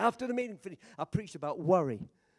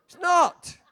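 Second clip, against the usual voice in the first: higher, louder, with a laugh; laughing insistence; 10 semitones higher, 10 dB louder than usual. Real, not acted. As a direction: repeat it, laughing and punching "not".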